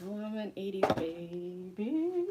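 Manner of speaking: normal talking voice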